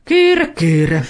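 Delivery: dog grumbling about busy humans, mock sigh; sighing old dog. A narrator's reading. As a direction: huff it out like a tired old dog. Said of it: "kuulosti melkein kuin se olisi huokaissut".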